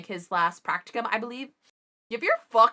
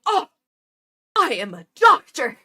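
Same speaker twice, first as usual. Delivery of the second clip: louder, indignant put-down; offended doctor; 5 semitones higher, 11 dB louder than usual. Retold or imitated. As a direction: snap it back like an insulted doctor. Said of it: "and he's like".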